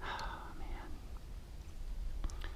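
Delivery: deflated, barely voiced; real dejected whispered sigh. Real, not acted. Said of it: "I could about wanna cry".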